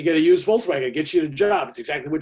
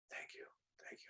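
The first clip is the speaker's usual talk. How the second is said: breathless whisper of gratitude; imitated whispered thank you; 30 dB quieter than usual. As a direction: barely voice it, overcome. Overwhelmed man thanks him.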